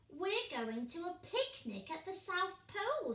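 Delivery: bright high chick voice. Acted. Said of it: "said Pog".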